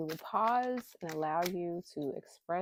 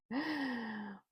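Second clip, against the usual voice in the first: short higher laugh; embarrassed giggle at the camera; 5 semitones higher, 3 dB quieter than usual. Real, not acted. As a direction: laugh at yourself.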